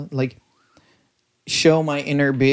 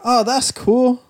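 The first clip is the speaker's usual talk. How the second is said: higher, louder retold exclamation; a little bit loud; 10 semitones higher, 5 dB louder, slower than usual. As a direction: pipe up a bit too loudly, retelling it. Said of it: "said a little bit loud".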